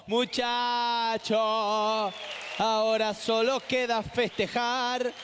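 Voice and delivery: hammed-up slow celebration call; drawn-out announcer. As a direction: drag it like a victory proclamation. Imitates a festive announcer.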